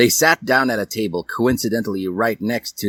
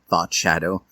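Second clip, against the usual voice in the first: usual speaking voice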